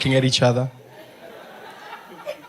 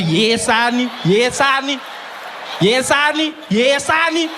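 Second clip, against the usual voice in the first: rising higher with each yelled honey; camp shrieking stabs; 8 semitones higher than usual. Retold or imitated. The butter-knife attack imagined, shrill camp yelling. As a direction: shriek it, climbing each time.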